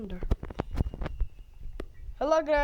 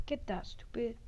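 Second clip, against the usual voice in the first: whisper; 3 semitones lower, 12 dB quieter than usual. Real, not acted. Sneaking from grandma.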